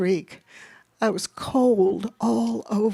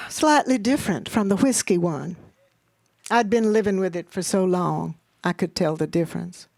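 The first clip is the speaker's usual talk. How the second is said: usual speaking voice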